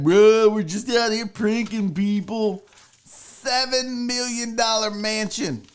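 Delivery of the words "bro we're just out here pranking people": slow, lightly put-on prankster voice; a hint of a lazy bro, mocking him; half-hearted bro impression. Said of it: "Yo, this cat is like".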